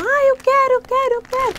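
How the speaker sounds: put-on high, pushy child begging for candy; high childish whine; whiny kid. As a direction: beg like an impatient little kid. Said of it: "Eles vão".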